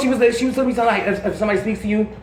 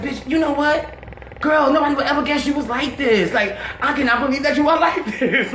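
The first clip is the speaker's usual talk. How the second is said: high-pitched retold disbelief, laugh breaking in at the end; acted-out excited amazement; amazed gushing; 7 semitones higher than usual. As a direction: gush at a friend in amused astonishment.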